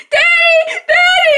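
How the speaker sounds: very high, loud mock wail; panicked child's cry. Child calling Daddy in a fire, acted panic. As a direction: imitate a scared kid shrieking for a parent.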